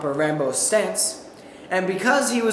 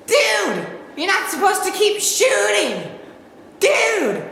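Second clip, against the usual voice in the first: yelling dude; 11 semitones higher, slower than usual. Retold or imitated. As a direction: yell it like an outraged teen. Teen yelling at shooter.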